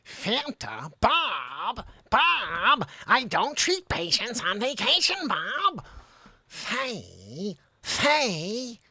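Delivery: hammed-up movie scene voices; What About Bob impression. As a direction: swing between needy Bob and fed-up Dr. Marvin. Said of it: "Doctor Marvin".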